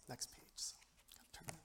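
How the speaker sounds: very soft whisper to oneself; barely audible self-prompt; whispered aside. Real, not acted. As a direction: whisper it under your breath while finding your place. Muttering to self while turning page.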